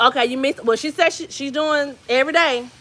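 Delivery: ordinary talk, nothing marked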